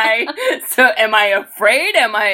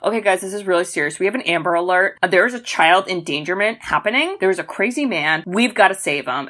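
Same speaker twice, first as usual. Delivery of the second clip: low, quick police briefing; brief the squad urgently, pitched down; grave cop parody, rattled off; mock serious police officer; 5 semitones lower than usual. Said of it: "police being like".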